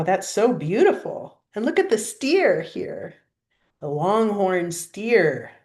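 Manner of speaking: usual speaking voice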